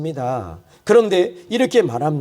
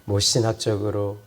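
much lower and softer, intimate; gentle exhortation; 8 semitones lower, 5 dB quieter than usual. Acted. Pastor gently addressing beloved saints, soft and low. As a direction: bring it down to a quiet, close appeal.

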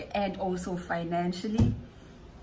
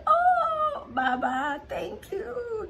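much higher, louder, crying out her thanks; tearful thanks; 4 dB louder, much slower than usual. Retold or imitated. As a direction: re-enact the overwhelmed sob of gratitude. Retelling being hugged by church elder.

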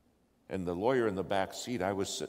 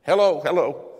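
high, very loud hail; insistent shout; 7 semitones higher, 13 dB louder than usual. Retold or imitated. Lawyer flipping channels for help, insistent shouted call. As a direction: shout hello into a silent radio. Calling again on radio.

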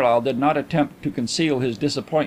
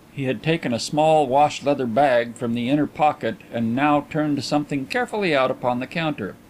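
ordinary delivery, nothing marked

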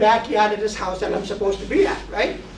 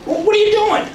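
much higher, louder put-on Karen voice; mock Karen demand; 13 semitones higher, 5 dB louder than usual. Karen demanding the drone pilot explain himself, high mock indignation. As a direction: play the indignant neighbor demanding answers.

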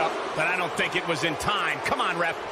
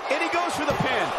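excited; 10 semitones higher than usual. Acted. Commentator calls a pin.

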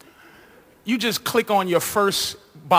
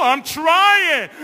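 put-on forced girly giggle; 6 semitones higher than usual. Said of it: "I'm like".

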